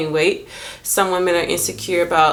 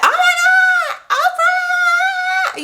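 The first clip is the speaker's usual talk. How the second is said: much higher, loud, drawn-out shriek; screaming fangirl; 4 dB louder, slower than usual. Pretend. Spotting Oprah, starstruck fan screaming her name. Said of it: "But I wouldn't be like".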